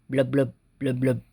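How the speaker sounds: low soft blubbing; fish bubbles. Pretend. Reader voicing the fish for kids, soft low bubbling. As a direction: blub like a fish underwater. Animal sounds in picture book.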